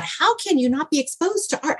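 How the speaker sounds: high, astonished question; astonished that young people get no art, disbelieving; disbelief. Retold or imitated. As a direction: ask it high with real disbelief. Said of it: "I thought".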